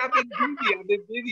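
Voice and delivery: high, whiny mock excuse; mock Trump whine. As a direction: whine the excuse like a cornered kid.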